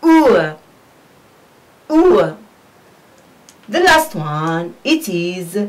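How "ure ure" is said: u as "oo-uh", falling; separate, punched model u; diphthong demo. Shown as a demonstration. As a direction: glide u from oo into schwa, twice. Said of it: "We pronounce it as".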